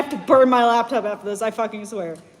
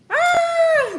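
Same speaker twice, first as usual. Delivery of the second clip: high-pitched acted scream. A narrator's reading. Lala screams in the story, high acted scream. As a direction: let out a high, sustained "ah". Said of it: "She screamed".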